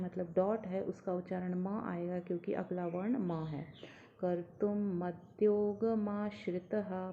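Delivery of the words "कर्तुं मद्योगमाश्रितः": "मद्योगमाश्रितः" slow, stressed on "मा"; slow recitation. Shown as a demonstration. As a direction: lean on "मा" in "मद्योगमाश्रितः". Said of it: "मा के ऊपर आघात".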